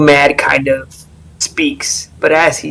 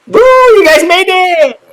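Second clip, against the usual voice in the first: high, loud cheering welcome; excited cheer; 5 dB louder than usual. Acted. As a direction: shout out to the arriving crew like a greeter on the dock. Welcoming party on shore.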